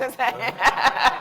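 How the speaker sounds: real, loud, high burst of laughter. Real, not acted. Panelist amused by an audience heckle, laughing. Audience member shouts that they care.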